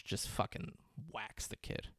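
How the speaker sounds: hushed, breathy menace; mock menacing hush. Emile Hirsch's character ordering the hit, hushed to a whisper. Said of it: "Emile Hirsch goes".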